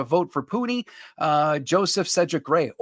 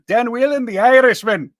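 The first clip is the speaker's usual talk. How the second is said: louder, higher Irish accent; 7 semitones higher, 8 dB louder than usual. Shown as a demonstration. Host proving he can do the Irish accent for a viewer, louder. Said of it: "That one I can do".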